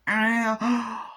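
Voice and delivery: high, drawn-out imitated groan; labour cry. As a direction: cry out as if pushing. Woman in labour cries out.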